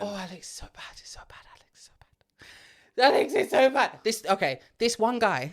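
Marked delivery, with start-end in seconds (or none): 0.0-3.1 whispered dismay. Real, not acted. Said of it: "repeats it in a whisper". 3.1-4.0 higher and louder, full-voiced complaint; exasperated outburst about a bad show. Real, not acted. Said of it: "same words said out loud".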